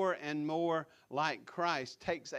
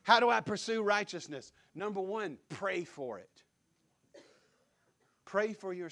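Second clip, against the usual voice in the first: louder and higher pulpit cry; full preacher voice; 4 semitones higher, 7 dB louder than usual. Real, not acted. Pastor crying out the sermon question in revival fervor. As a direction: call the question out to the whole church. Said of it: "I don't know what happened to me".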